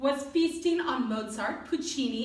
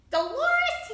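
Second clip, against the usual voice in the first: high, loud, bold call; strong and brave; 5 dB louder than usual. Acted. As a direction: call her name bravely. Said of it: "with a voice strong and brave".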